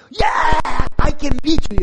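loud, high victory cry; gloating shout. Retold or imitated. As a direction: crow over the win. Neighbor gloats after winning.

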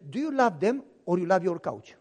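wife calming her fuming husband, low and firm; wife's calming voice. Retold or imitated. Wife voiced questioning him.

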